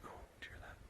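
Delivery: hushed, breathless whisper mid-sentence; sudden whisper. Real, not acted. Hearing a noise in the woods, a real whisper. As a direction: drop to a whisper as if something is near.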